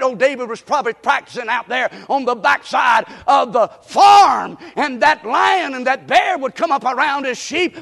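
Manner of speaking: rising, excited sermon storytelling that builds to shouts; fired-up preaching. Real, not acted. Preacher telling how David killed bears and lions, fired up. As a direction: build the sling story to a whooping climax.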